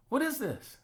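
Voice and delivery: a father frowning at a bad drawing; stern dad. Father looking at his son's suit drawing, sharply unimpressed. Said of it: "he goes".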